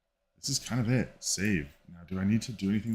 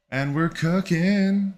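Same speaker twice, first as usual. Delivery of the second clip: excited; 10 semitones higher, 8 dB louder, slower than usual. Real, not acted. Celebrates finished edit.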